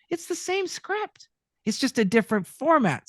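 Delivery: high, lightly voiced surprise; excited retold realization. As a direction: play a sudden realization. Acting own realization, high line.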